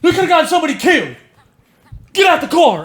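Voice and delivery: fast, high, half-laughing mock shout; imitated cop yelling. Cop ordering the driver out, in a fast high mock yell. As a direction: rattle off the cop's orders with a laugh. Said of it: "the dude starts yelling".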